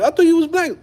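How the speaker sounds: high-pitched puzzled questioning; mock surprise. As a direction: squeak it out in exaggerated confusion. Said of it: "you be like".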